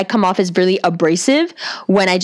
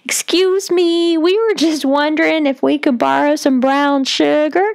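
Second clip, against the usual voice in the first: put-on high polite voice; mock high-pitched, drawn-out politeness; Martin Lawrence; 8 semitones higher than usual. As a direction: squeak it out high and slow, harmless as can be. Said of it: "You gotta talk in a high voice".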